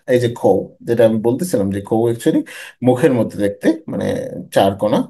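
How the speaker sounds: usual speaking voice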